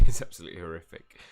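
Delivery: laughing at a horrible outfit. Real, not acted. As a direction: blurt it through a laugh.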